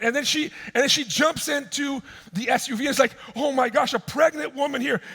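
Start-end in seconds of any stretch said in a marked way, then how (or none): 3.4-5.0 high, loud imitated shock; acted-out alarmed exclamation; mock alarm. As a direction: react in mock panic, voice pitched way up. Acted shock at pregnant woman.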